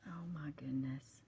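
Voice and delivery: hushed, soft murmur of admiration; near-whisper, voice held right down. Real, not acted. Crafter admiring printed sheets for viewers, whispering. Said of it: "I don't know why I'm whispering".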